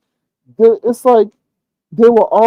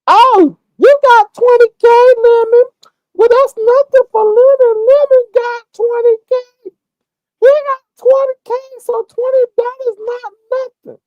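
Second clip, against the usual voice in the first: much higher, louder put-on woman's voice; loud gushing woman; 5 dB louder than usual. Imitating a woman announcing the man's money, high and loud. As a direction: gush it out shrill and bold. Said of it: "Letting it stand or staking in the ground is".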